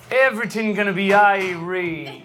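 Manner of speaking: put-on slow, laid-back Caribbean accent; imitating a reassuring Caribbean worker's accent. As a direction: draw it out in an easygoing Caribbean lilt. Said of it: "your best Caribbean accent".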